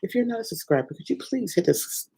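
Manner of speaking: lightly voiced YouTuber sign-off plea; mock YouTuber ask. Shown as a demonstration. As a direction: put on a sweet creator plea. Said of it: "I've always wanted to say this".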